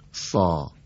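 سا with a heavy, thickened s like Arabic ص and a long open a. Shown as a demonstration. Teacher models heavy سا for the student, thickened. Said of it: "مثل سین فارسی اما درشتر".

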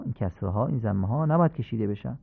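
ordinary delivery

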